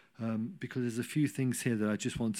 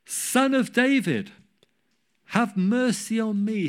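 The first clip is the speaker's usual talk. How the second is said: high, loud insistent cry; shouting all the more; 7 semitones higher, 5 dB louder than usual. Retold or imitated. Beggar rebuked by the crowd, shouting louder. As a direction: refuse to be silenced, call louder. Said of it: "He shouted all the more".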